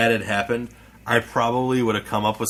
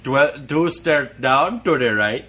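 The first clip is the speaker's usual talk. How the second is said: slow, louder put-on Indian accent; mock Indian accent; 4 semitones higher, 4 dB louder than usual. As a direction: imitate an Indian-accented usher, higher and drawn out. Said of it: "Yeah, or Indian accent".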